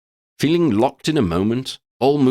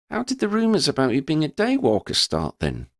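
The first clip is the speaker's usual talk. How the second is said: put-on higher, questioning young voice; curious Lydia; 7 semitones higher than usual. A narrator's reading. Lydia asks Verdi about the rumours, curious. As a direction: ask it with open curiosity. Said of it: "Lydia queried".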